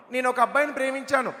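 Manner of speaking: lightly voiced teenage girl; teenage daughter. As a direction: play a daughter confessing to her father.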